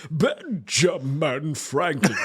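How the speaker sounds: very slow, drawn-out Franklin voice; slow stately mock announcement. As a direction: stretch the name as a stately Franklin. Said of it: "how would he sound".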